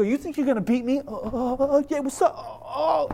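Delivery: high-pitched mock taunting; hammed-up trash talk to little opponents; playful smack talk. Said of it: "I start talking smack to the other kids".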